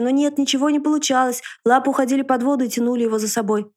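usual speaking voice

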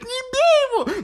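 very high panicked shriek; panicked scream. Retold or imitated. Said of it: "девушка начала кричать".

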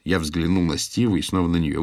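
plain reading voice

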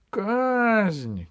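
very high, drawn-out cry; crowd shout. A narrator's reading. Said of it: "кричали в толпе". (высокий протяжный крик из толпы)

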